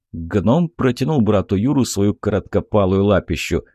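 ordinary delivery, nothing marked

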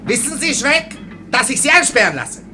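normal talking voice

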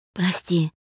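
subdued, soft apology; quiet, upset. A narrator's reading. Dasha ashamed of snapping at him, quietly upset. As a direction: apologise gently, as if downcast. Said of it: "так же тихо и как будто расстроенно".

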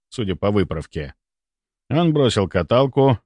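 ordinary delivery

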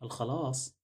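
softly puzzled question. A narrator's reading. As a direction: ask it quietly, bewildered. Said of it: "فتساءلت فيرا بحيرة". (بحيرة وصوت خافت)

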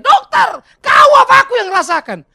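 high, very loud shouted challenge; hammed-up patient refusing to back down; stubbornly insistent. Patient still arguing with the doctor, stubbornly insistent. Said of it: "Masih ngotot dia".

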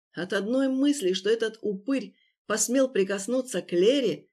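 plain reading voice

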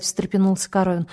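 ordinary delivery, nothing marked

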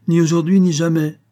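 loud, high and curt; curt retort. A narrator's reading. Old mother cutting off the suitor, a loud curt retort. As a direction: slam the door on him. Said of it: "répliqua sèchement Clémence".

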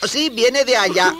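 put-on very high, loud, drawn-out boy voice; high-pitched, excited youngster; eager boy. Boy locates the distant crying.

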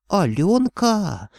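high, drawn-out sorcerer's cry; old sorcerer perking up, high and lingering; interested sorcerer's croak. A narrator's reading. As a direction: croak it out slowly with keen interest. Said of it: "заинтересованно прохрипел колдун".

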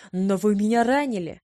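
loud protest. A narrator's reading. Wounded man protests loudly.

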